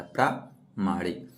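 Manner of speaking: "प्रामाणिक" drawn out syllable by syllable; slow, careful. Shown as a demonstration. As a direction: spell "प्रामाणिक" out slowly for students. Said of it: "प्रामाणिक होता है".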